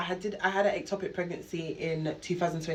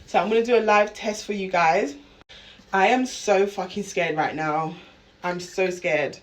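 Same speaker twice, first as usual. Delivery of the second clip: ordinary talk, nothing marked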